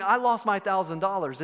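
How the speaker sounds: acted matter-of-fact admission, in full voice; steady, accepting reply; composed response. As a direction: concede the loss without falling apart. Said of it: "And your response is".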